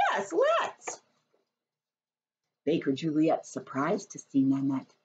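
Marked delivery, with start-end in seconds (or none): 0.1-1.0 put-on very high child's voice; little girl voice. Nanette voiced in story.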